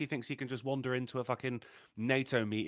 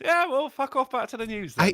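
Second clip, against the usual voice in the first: higher, louder dismissive snap; bouncer retort; 8 semitones higher, 5 dB louder than usual. Retold or imitated. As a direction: brush him off like a doorman.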